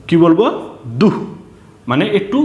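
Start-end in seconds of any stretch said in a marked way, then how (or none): 1.0-1.1 দুহ said short and forceful with a breathy h ending; forceful visarga. Shown as a demonstration. Teacher showing how visarga sounds, দুহ said with force. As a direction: punch out দুহ, cut off on a puff of h. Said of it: "জোর দিয়ে বলবো".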